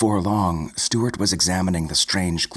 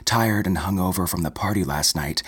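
ordinary delivery

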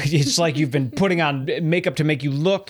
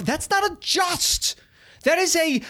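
exasperated rant; 10 semitones higher than usual. Real, not acted. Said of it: "ranting about just".

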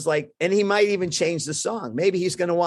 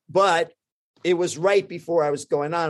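ordinary talk, nothing marked

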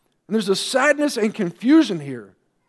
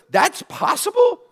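much higher, incredulous "That's possible?"; amazed question; 9 semitones higher than usual. Acted. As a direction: marvel at it as if it can't be true. Preacher marvels at incarnation.

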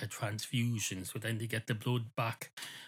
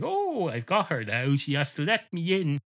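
higher, louder, pleased with himself; proud and smug; 6 semitones higher, 5 dB louder than usual. Acted. Dog gloating he's outwitted the clerk, smug and pleased. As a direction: gloat like you've caught her out. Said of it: "All proud of himself".

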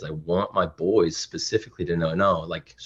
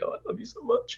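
high, soft, choked-up imitation; playfully weepy and tender, pitched way up; mock tearful gushing; 9 dB quieter than usual. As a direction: squeak it out as if welling up with love. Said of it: "I'm more willing to, like, cry".

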